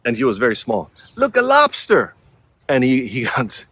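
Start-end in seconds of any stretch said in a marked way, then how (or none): 1.2-2.2 put-on high, bright child's exclaim; excited little girl. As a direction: squeal it like a delighted kid. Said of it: "she looked at this tattoo".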